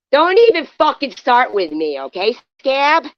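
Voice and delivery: real yell to shut her down; furious shout. Real, not acted. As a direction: bark the insult at her. Heated livestream quarrel, yelling.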